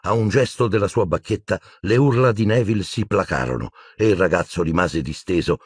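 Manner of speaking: usual speaking voice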